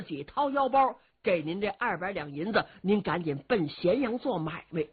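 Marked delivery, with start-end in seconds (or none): none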